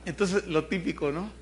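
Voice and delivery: normal talking voice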